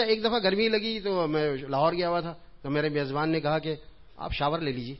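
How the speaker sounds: ordinary talk, nothing marked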